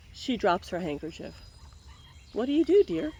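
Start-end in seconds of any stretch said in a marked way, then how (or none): none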